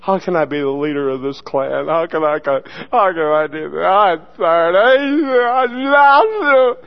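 a pastor moaning alone in his office; mock lament. Self-pity in office.

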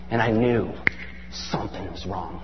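low, very soft, suspenseful; hushed and ominous. Real, not acted. Parent telling of a child's night terrors, ominous hush. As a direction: drop right down for the dread.

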